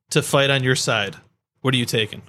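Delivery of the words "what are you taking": usual speaking voice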